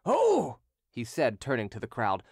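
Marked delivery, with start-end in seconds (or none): 0.1-0.6 high, loud exasperated "Oh!"; angry shout. Acted. Creditor turning on the crowd, loud angry cry. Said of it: "continued to shout".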